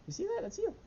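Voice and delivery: very high squeaky pet voice; pet talk. Real, not acted. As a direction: excitedly point it out to the dog. Showing dog the screen.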